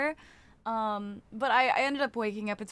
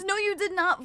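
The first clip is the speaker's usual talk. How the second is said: high, louder playful no you did not; teasing denial; 8 semitones higher, 4 dB louder than usual. Real, not acted. Calling out a chatter who claims they came early, high and teasing.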